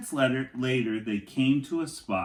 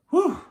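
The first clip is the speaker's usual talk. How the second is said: relieved whew; 6 dB louder than usual. Acted. Relief after the danger.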